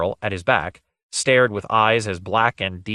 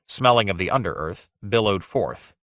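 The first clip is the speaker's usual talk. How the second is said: usual speaking voice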